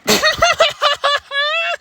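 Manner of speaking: long, high-pitched laughing fit; uncontrolled high cackling laugh; real laughter. Real, not acted. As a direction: burst out in helpless high laughter. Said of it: "I'm sorry for laughing".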